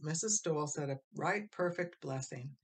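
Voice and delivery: usual speaking voice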